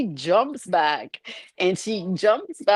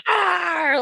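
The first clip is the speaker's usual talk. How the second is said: sudden high mock roar jumping out; high-pitched "arr" scare shout; dad's scare roar; 12 semitones higher, 3 dB louder than usual. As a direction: leap out with a playful scare yell. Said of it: "and he's like".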